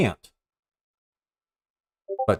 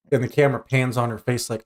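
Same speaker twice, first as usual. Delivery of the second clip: ordinary talk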